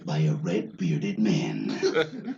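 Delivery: low trailer announcer, slow ending; movie trailer voice. Shown as a demonstration. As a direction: narrate it like a film trailer. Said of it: "trailer guy".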